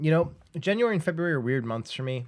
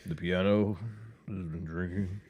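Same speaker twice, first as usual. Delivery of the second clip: put-on town drunk; 5 semitones lower, 4 dB quieter than usual. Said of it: "I told you I sound like [name]".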